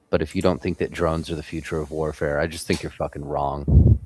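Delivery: ordinary talk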